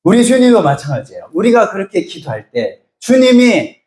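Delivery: ordinary delivery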